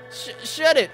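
high, loud stammered retort; stutter, then cut them off loudly; acted angry outburst. Acting a character snapping back.

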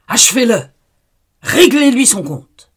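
voix de démon aiguë et hurlée; cri démoniaque. Acted. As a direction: hurle à la mise à mort. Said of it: "criait Asmodée". (cri de démon)

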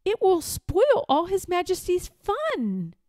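put-on high, pleading boy's voice; timid protest. A narrator's reading. Page boy protesting to Lord Droon, timid. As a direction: object nervously to a superior. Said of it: "he stammered".

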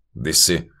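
ordinary delivery, nothing marked

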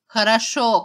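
loud sharp cry; angry shout. A narrator's reading. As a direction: shout it, fed up. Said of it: "крикнула она".

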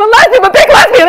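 high, loud exaggerated yell; hammed-up loud declaration; mock shout. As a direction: belt it out as a big announcement. Escalating coming-out quote.

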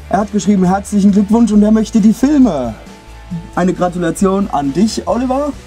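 loud, high, thrilled; excited congratulations. Real, not acted. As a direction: cheer the winner's name. Congratulating the winner.

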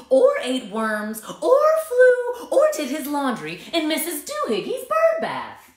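high, loud scolding mother. A narrator's reading. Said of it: "she scolded".

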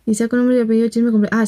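usual speaking voice

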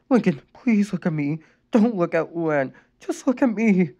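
high, tearful urging. A narrator's reading. Mother urging her son, tearful. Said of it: "Rita urged him".

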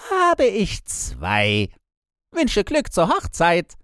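slow then shrill high, loud devilish glee; gleeful devil. A narrator's reading. Devil gloating over two souls, mocking the wedding. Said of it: "und sagte".

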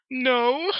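very high, drawn-out yawned no; hammed-up sleepy yawn; through a yawn. A narrator's reading. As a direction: answer while yawning, pitch way up. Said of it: "through a yawn".

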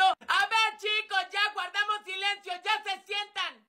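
high-pitched exasperated teacher; teacher scolding. Acted. Teacher quieting unruly pupils, scolding. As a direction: call for order in a high voice. Teacher calls class to order.

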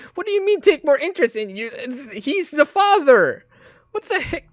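high, loud outraged yell. Real, not acted. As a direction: explode in disbelief. Outraged at diary line.

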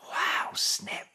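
whispered amazement; awed whisper. A narrator's reading. Max amazed at Snip's dad, awed whisper. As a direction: breathe it out in wonder.

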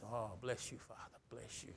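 very soft, low murmured blessing; hushed praise. Acted. Worshipper blessing the Father, hushed. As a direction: murmur it intimately to God. Acting out praise under open heaven.

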